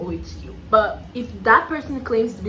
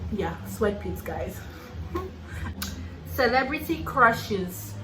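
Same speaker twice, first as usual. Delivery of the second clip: ordinary talk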